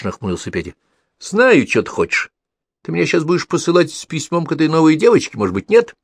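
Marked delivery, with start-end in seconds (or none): 1.2-6.0 high, cocky little brother; cheeky. A narrator's reading. Pavlik smugly teases his older brother, high cocky kid voice. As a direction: tease him with a smug kid voice.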